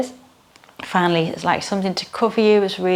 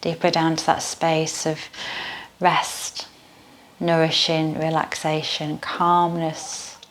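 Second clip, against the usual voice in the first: guided meditation. Acted. Leads yoga nidra meditation.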